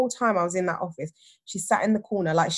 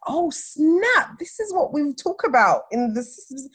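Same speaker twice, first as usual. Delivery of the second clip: high-pitched dawning shock; retold inner gasp of recognition; sudden realisation; 8 semitones higher, 3 dB louder, slower than usual. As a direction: exclaim it as the penny drops. Voices own sudden realisation.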